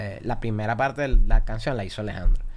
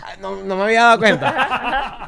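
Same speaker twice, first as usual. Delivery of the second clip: high, loud, slow mock surprise; playing oblivious; 9 semitones higher, 7 dB louder, slower than usual. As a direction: pretend you only just noticed, hammed up with a laugh. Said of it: "haciéndome el loco". (haciéndose el loco, sorpresa exagerada)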